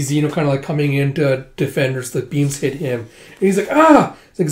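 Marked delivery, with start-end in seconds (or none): 3.7-4.1 short, high, loud yelp; acted cry of pain. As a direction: yelp as if struck. Beam hits Zeno.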